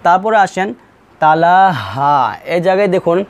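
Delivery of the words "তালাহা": "তালাহা" with a long rising final "হা"; "হা" held open at the stop; one-alif stretch. Shown as a demonstration. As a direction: draw out the last vowel of "তালাহা". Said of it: "এক আলিফ অবশ্যই টান দিতেই হবে".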